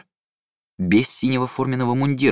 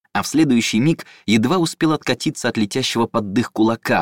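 plain reading voice

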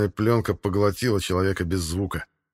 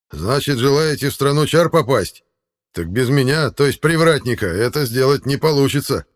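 smug, higher creature voice; gatekeeper; 6 semitones higher, 7 dB louder than usual. Acted. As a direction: lord it over the travellers. Gatekeeper boasts of his role.

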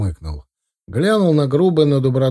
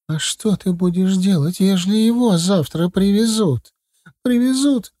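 much higher, rising panic; frightened mother; 8 semitones higher than usual. A narrator's reading. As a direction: voice climbs in fear. (испуганная мать, голос взлетает)

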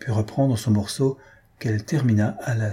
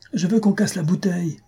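put-on boy's cry; 9 semitones higher than usual. A narrator's reading. Boy with tongue stuck in a bottle, crying out. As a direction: whine it out high like a child. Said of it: "criait le galopin".

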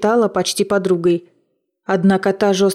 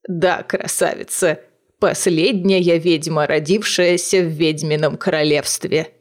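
put-on old witch voice; 3 semitones lower than usual. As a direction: purr it like a proud old hag. Witch introduces herself.

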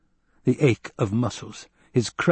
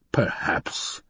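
muttered; 2 semitones lower, 4 dB quieter than usual. Acted. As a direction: mutter it to himself. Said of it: "the Baron muttered".

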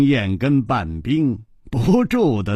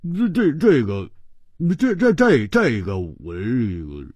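glum stammer; 2 semitones lower than usual. Acted. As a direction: stutter helplessly, running out of words. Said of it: "胡铁花苦着脸说". (苦着脸结结巴巴地说)

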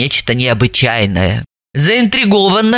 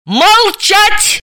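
very high, sharp bark for silence; barked command; 3 dB louder than usual. A narrator's reading. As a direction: bark an order to shut someone up. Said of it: "Далила гаркнула". (гаркнуть, резко и высоко)

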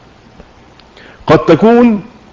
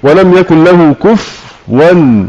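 slow, drawn-out Quran recitation; much slower than usual. Shown as a demonstration. Teacher reciting an example verse to students, slow recitation. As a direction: recite كفوا slowly. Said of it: "زي".

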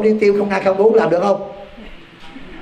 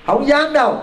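mocking reply; 8 semitones higher than usual. Real, not acted. Answers own question to congregation.

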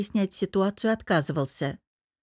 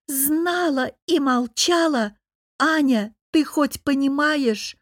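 high, slow shrieking accusation; 7 semitones higher, 3 dB louder, slower than usual. A narrator's reading. Lera shrieking at her friend for keeping silent. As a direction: screech it at her. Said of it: "заверещала".

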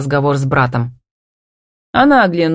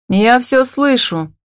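high, drawn-out interjection; overhearing woman; 8 semitones higher, slower than usual. A narrator's reading. Woman nearby announcing she overheard, drawn out. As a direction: stretch it out from nearby. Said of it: "раздался неподалёку голос Фокиной".

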